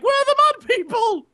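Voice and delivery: high, loud performer's yell; hammed-up high shout; comedy-routine cry. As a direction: holler it like a fairground act. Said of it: "and be like".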